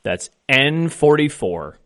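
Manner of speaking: slow, deliberate number calling; bingo caller. Acted. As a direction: call each ball slowly with falling ends. Calls each bingo ball twice.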